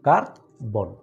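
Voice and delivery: Carbon in two separate steps, কার, pause, বন; teacher showing two steps in carbon; split into syllables. Shown as a demonstration. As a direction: break carbon cleanly after কার. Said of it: "দুইবার দুইটা step নিতে হয়".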